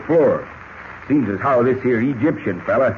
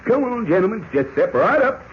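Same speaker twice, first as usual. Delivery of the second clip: playful auctioneer patter, pitched higher; mock auction call, a lightly played auctioneer; 9 semitones higher than usual. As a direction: hawk yourself off like a county-fair auctioneer. Selling himself at mock auction.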